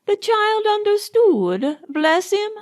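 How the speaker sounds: put-on a fond old nurse blessing the child; old Nanny. A narrator's reading. Old Nanny to Burns about the child, lightly voiced. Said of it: "old Nanny said to Burns".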